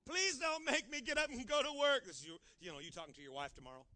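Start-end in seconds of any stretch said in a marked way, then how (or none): 0.1-2.0 put-on high, loud pleading child; child begging his daddy, loud and high. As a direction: whine and beg like a small kid. Husband begging wife.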